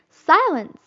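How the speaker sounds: sharp loud call for silence; cried out. A narrator's reading. Wendy crying silence over the noisy children. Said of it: "cried Wendy".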